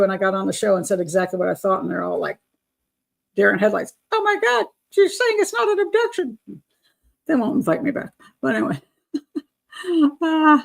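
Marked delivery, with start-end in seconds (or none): 4.1-6.4 high-pitched horrified gasp of a line; mock shock. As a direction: imitate scandalized TV hosts, pitched way up. Mimics stunned news hosts reacting.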